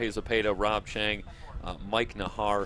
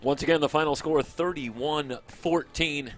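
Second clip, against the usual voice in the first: slow emphatic score call; announcer; 6 semitones higher, 5 dB louder, slower than usual. Real, not acted.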